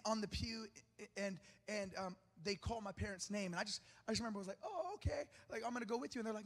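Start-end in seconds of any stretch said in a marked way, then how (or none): 4.6-6.2 put-on five-year-old self. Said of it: "I was only five years old".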